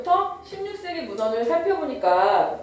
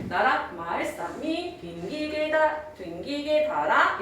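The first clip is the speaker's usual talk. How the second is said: slow tonal chant of "나랏, 말, 싸미, 빙기게"; 중세국어 성조 낭독; 4 semitones higher, much slower than usual. Shown as a demonstration. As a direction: draw out "싸미" and "빙기게", gliding between tones. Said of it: "이렇게 읽었다는 거야". ("나랏, 말, 싸미, 빙기게"를 성조대로 천천히)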